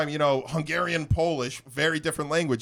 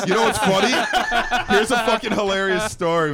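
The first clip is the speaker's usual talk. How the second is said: laughing; 4 semitones higher, 4 dB louder than usual. Real, not acted. Laughing while starting story.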